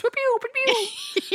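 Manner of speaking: high, slow sung trumpet noise; trumpet imitation. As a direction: toot it like a goofy trumpet. Imitating the professor's trumpet playing.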